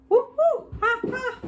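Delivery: loud, very high monkey hoots; monkey call. Pretend. Teacher reading along with children, monkey call. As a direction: whoop it out like an excited monkey. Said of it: "I wanna do a monkey voice".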